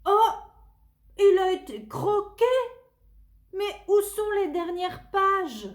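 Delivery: put-on high, loud startled child voice; surprised exclamation. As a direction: gasp it out in surprise. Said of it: "s'exclame-t-il".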